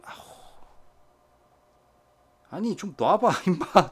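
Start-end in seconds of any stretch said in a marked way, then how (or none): 2.5-3.9 high, loud yelp of a scold; startled scold. Real, not acted. Owner yelling at hamster gripping her finger, loud high scold. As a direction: tell the biting pet to let go, loudly. Pet biting onto the owner.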